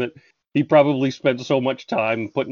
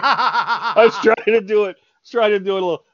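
high voice squeezed by laughter; laughing helplessly; 10 semitones higher than usual. Real, not acted. Reader excusing the failed take, laughing. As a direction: explain through real laughter. Still laughing at own reading.

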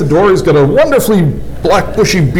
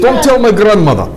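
put-on Russian accent; 7 semitones higher than usual.

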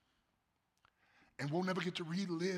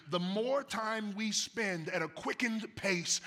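slower, louder, rising preaching; mounting sermon delivery; 6 semitones higher, 6 dB louder, slower than usual. Real, not acted. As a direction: build the line toward the peak. Preaching builds again.

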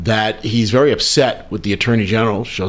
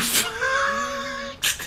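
burst of laughter; 11 dB quieter than usual. Real, not acted. Breaks up laughing mid take.

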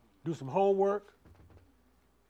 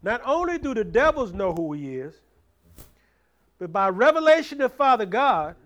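raised, louder preacher's drive; preaching at full force; 4 semitones higher, 6 dB louder than usual. Acted. Preacher drives the point home to the church, loud. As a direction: push it out to the congregation.

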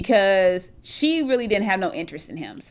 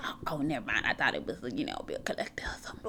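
much softer, fast whispered aside; hushed relief; 9 semitones lower, 13 dB quieter, faster than usual. Retold or imitated. Realizing the caller was only Kim, hushed whisper.

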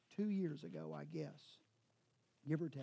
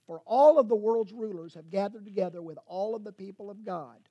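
scripture reading; 2 semitones higher, 7 dB louder than usual. Acted. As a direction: read the psalm as proclamation. Rereads the psalm verse.

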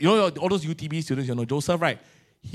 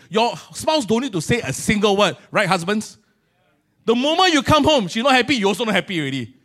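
normal talking voice

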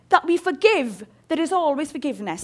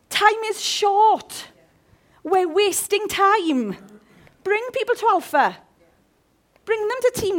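urgent slow preaching; 5 semitones higher, slower than usual. Real, not acted.

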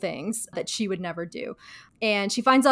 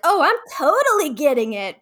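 higher, louder airy mock-Phoebe; breezy Phoebe; 6 semitones higher, 6 dB louder than usual. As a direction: toss it off happily in a higher voice. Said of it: "Phoebe's like".

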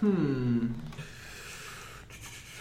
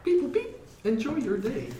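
toy robot voice; 8 semitones higher than usual. Acted. Robot medic sign-off.

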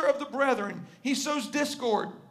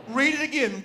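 ordinary talk, nothing marked

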